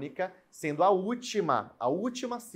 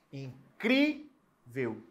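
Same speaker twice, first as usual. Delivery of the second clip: "incrível" extremely slowly, syllable by syllable; long held "cri" in "incrível", gap before "veu"; stress on CRI; much slower than usual. Shown as a demonstration. Teacher proving which syllable is tonic, stretched "cri" in "incrível". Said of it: "O cri é a sílaba tônica".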